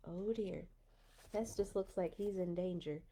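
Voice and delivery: ordinary delivery, nothing marked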